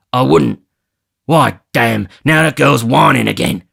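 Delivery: loud, exasperated; irritated Sikes. Acted. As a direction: swear at her.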